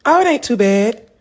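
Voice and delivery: ordinary talk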